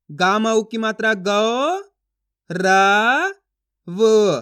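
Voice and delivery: slow chant, गौ rising, रा and वो loud; syllable spelling drill. Shown as a demonstration. Teacher sounding out a word for learners, drawn out. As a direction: stretch गौ, रा, वो apart with force. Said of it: "इस तरीके से पढ़ना आपको".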